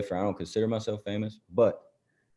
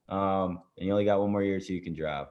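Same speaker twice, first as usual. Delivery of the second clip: normal talking voice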